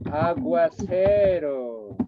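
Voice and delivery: aguacero spelled out very slowly, syllable by syllable; original aguacero. Shown as a demonstration. Teacher slowly giving the Spanish source word, very slow. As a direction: draw out aguacero slowly so they hear the source. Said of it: "Aguaciru viene de".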